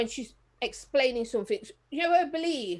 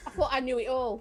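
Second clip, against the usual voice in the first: ordinary talk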